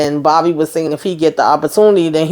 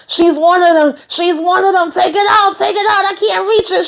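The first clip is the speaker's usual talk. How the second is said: much higher, rising frantic hammed-up panic; paranoid panic; 10 semitones higher than usual. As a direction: beg her to pull the drip out. Drugged Sam panicking in hospital.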